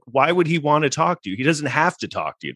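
usual speaking voice

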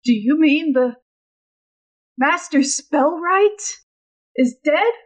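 halting, questioning disbelief, slowing on the name; a touch of stunned disbelief, pausing between phrases; taken aback. Acted. Juggler hearing news of the death, mildly taken aback. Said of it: "and he's like".

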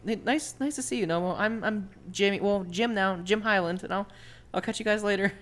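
shy dwarf Jimmy talking to Nomo; timid dwarf Jimmy. Acted.

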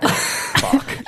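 whispered curse. Retold or imitated. Retold frustration at unbeatable boss.

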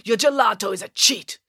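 high-pitched, indignant denunciation; accusing. A narrator's reading. Man condemns the nun's gelato, accusing. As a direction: denounce it with outrage. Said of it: "accused Ezio".

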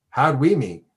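ordinary talk, nothing marked

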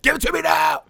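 high demanding shout. Retold or imitated. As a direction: scream it like a ghost. Imitating the ghost's demand.

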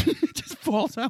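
high laughing question. Real, not acted.

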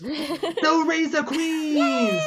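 high, very drawn-out announcement; announcer's proclamation. Acted. Big Notch announcing the team name, high drawn-out call. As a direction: stretch the name out for the crowd.

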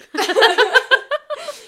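big burst of laughter; loud laugh. Real, not acted. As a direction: crack up laughing. Laughing at friend's story.

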